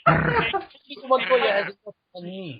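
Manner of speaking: high put-on voice with overdone rolled R's; Cowardly Lion. Practicing rolled R's for laughing friends, comic growly trill. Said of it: "sound like the Cowardly Lion".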